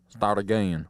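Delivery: "again" drawn out in a low Southern drawl; Southern accent. Retold or imitated. Customer demanding a new sandwich, low Southern drawl. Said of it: "why did he become a Southerner".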